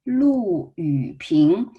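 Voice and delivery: LU falling, YU dipping, PING rising, slowly; slow syllable-by-syllable Lu Yuping with clear tones; model tone reading. Shown as a demonstration. Teacher asks learners to read after her, slow toned Lu Yuping. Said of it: "read after me".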